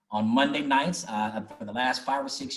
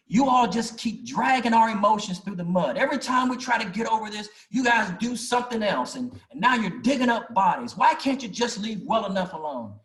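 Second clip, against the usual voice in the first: higher and louder, imitating an aggrieved old man; fast, raised mock outburst; upset; 8 semitones higher, 4 dB louder than usual. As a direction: let the retold complaint pour out, raised and pained. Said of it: "And he was upset".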